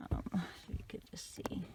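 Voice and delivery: soft whispered aside. Real, not acted. Crocheter shows viewers the stitch in a whisper. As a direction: breathe it out in a whisper. Aside while showing the eyes.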